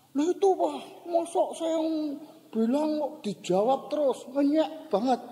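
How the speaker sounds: put-on high-pitched kid's grievance; lightly voiced indignant child; complaining child. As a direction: imitate a boy protesting to his dad. Aggrieved child complaining to his father.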